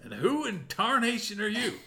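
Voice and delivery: put-on old prospector. GM voices Deputy Bob.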